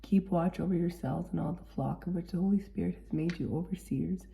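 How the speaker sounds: ordinary talk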